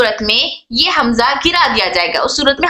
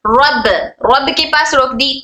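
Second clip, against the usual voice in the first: normal talking voice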